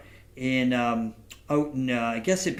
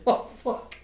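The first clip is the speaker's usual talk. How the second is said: breathless whispered "oh, fuck"; an awestruck aside under the breath; whispered curse; 8 semitones higher than usual. Retold or imitated. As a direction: whisper it to yourself, stunned. Startled aside when asked to sing.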